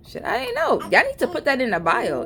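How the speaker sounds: high-pitched protest of ignorance; mock indignant retort. Customer answering disgruntled Thumbtack companies, mock indignant. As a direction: pitch it up like it's news to you.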